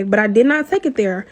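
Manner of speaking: normal talking voice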